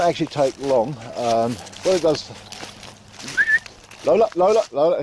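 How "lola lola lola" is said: calling the dog. Real, not acted. Owner calling Lola back in on the walk. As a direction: call her name twice to bring her in.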